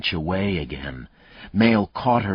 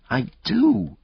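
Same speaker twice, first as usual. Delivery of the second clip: sobbed; 4 dB louder than usual. Acted. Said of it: "Grace sobbed".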